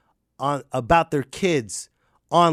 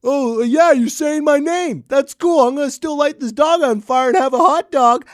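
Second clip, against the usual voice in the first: put-on much higher, louder smug kid; a brat shrugging off his name; mock bratty kid; 10 semitones higher, 7 dB louder than usual. A bratty kid mocking the parent who says his name.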